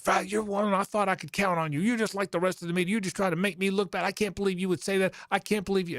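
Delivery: high-pitched mock rant from the coach; imitating angry coach. Coach on the phone scolds the sportswriter, high mock anger. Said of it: "he is giving me the business".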